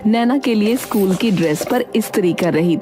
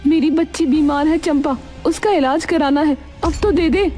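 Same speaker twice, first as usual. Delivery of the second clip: urgent plea; 8 semitones higher than usual. Acted. Friend pleads for repayment.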